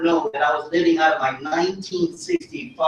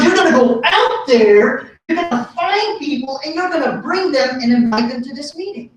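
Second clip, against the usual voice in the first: higher, emphatic imitation of instructions; lightly voiced friend's mom; 6 semitones higher than usual. Friend's mom sending him out to recruit, lightly imitated. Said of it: "She goes".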